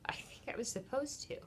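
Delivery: hushed, voiceless admission; whisper. Real, not acted. To viewers, confessing she was meant to do this alone, whispered. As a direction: let it slip out as a bare whisper. Confiding about facing this alone.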